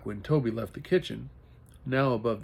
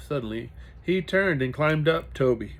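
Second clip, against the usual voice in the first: ordinary delivery, nothing marked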